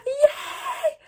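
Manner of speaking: high, loud squeal of delight; real happy yelp; excited squeal. Real, not acted. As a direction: cheer with tearful joy. Reading a kind note from a viewer.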